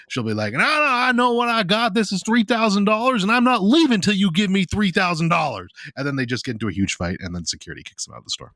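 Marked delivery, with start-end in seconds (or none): 0.5-5.7 high, pushy mock customer refusing to budge; insistent customer. Customer insisting to the pawn dealer, high and stubborn. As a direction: demand your price, voice up high and stubborn.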